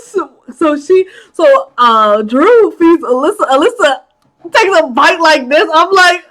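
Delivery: usual speaking voice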